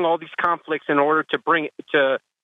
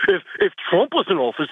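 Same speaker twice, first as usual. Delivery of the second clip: goofy clueless Trump voter, higher; dumb guy voice; 4 semitones higher, faster than usual. Pretend. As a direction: play the dopey supporter for laughs. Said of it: "the stupid, uh, Trump voter again".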